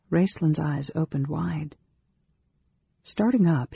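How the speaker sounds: usual speaking voice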